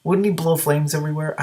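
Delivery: plain reading voice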